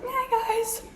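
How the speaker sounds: high, put-on sweet hello; smiling greeting. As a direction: greet strangers through a forced smile.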